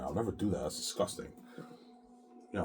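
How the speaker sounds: ordinary talk, nothing marked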